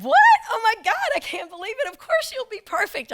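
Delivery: high-pitched, pushy, overeager shout; shrill fake-sweet office bully; eager coworker. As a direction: squeal it like a pushy coworker volunteering someone. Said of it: "And she said".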